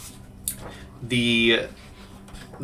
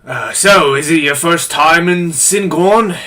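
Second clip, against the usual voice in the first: lifted, louder boatman's question; older boatman; 5 semitones higher, 5 dB louder than usual. Acted. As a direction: hail the passengers as an old boatman. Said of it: "the older man on the boat".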